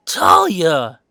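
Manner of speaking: loud, thrilled shout, the last word stretched slowly; cheering Talia for punching him, a loud delighted shout; excited cheer. Acted. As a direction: yell it out in delighted disbelief.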